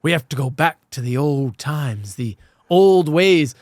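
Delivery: lightly voiced old-ways cultist; quoting the villain. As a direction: intone it like a solemn villain. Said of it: "because he's like".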